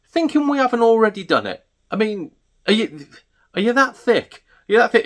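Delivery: high, exasperated disbelief; incredulous exasperation. Real, not acted. Stallholder ranting about people scanning his stock, incredulous. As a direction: rant in baffled indignation.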